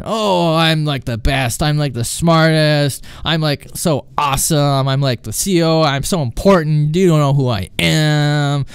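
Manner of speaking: slow, stretched-out mock bragging; smarty-pants customer. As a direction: boast like a self-important caller. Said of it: "smarty pants customers who".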